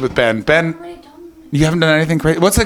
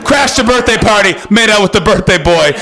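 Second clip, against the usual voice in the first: ordinary delivery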